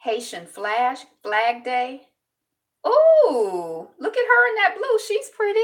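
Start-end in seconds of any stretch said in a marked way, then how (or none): 2.9-5.6 loud, high cooing; real excited admiration. Real, not acted. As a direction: squeal your admiration, pitched way up. Admires woman in a picture.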